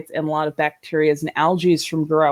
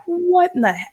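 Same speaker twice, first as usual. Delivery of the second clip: shocked exclamation; 11 semitones higher than usual. Retold or imitated. Owner smelling the fouled tank, shocked and high. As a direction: voice jumps high in disgusted shock.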